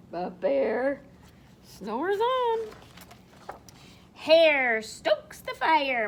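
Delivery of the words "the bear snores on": drawn-out, drowsy storybook line; slow sleepy refrain. A narrator's reading. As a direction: stretch it out like a lullaby.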